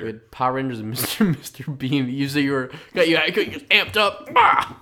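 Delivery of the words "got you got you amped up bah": hyped build into a loud, high burst; amped-up yell. Acted. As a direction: pump it up, ending on a high shout. Acting out being amped up.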